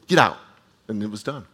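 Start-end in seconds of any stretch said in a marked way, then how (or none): 0.1-0.4 short, louder, fed-up order; annoyed command. Retold or imitated. Paul fed up with the spirit, annoyed louder command. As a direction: snap the order out, done with it. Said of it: "he got annoyed and he's like".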